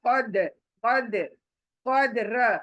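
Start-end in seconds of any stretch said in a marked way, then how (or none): 0.1-1.4 कद said slowly with a hard, bouncing stop on the द; slow कद with a crisp echoing द; qalqala bounce. Shown as a demonstration. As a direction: snap the द in कद firmly and release it. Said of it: "इसलिए द को एकदम से सख्ती से पढ़ना है".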